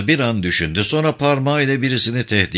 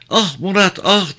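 high little-girl voice, playacting lament; small girl's mock yearning, pitched high; child mimicking lovesick sighs; 7 semitones higher than usual. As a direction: cry out like a child copying a lovelorn woman. Child mimics lovesick woman beating chest.

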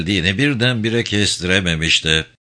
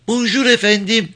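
put-on very high child's voice; high-pitched little pupil reciting; little girl; 10 semitones higher than usual. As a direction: recite politely as a small child. Small child answers greeting.

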